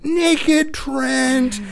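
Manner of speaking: put-on high, gleeful kid shout; little boy's cry. Dad voicing his son streaking down the hallway, high gleeful yell. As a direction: belt it out like a proud toddler. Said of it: "and goes".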